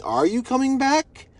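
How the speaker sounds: pointed retold question; imitating serious questioning. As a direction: draw out dad's doubting question, rising at the end. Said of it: "this echoing in my, in my mind of him saying".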